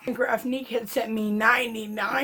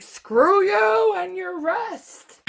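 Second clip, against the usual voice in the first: much higher, louder, slow playful outburst; mock defiance; 10 semitones higher, 6 dB louder, much slower than usual. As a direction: shout it back at them, half joking. Defying friends who tell her rest.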